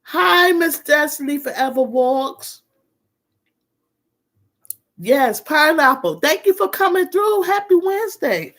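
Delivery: high, bright-voiced hello; excited greeting. Real, not acted. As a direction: greet them with a big lift. Greeting viewer on live.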